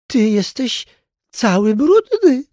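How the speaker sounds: high, loud, drawn-out disdain; haughty princess. A narrator's reading. Princess doll telling the clown he is dirty, haughty. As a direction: princess voice, stretched out in disgust. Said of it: "odezwała się księżniczka".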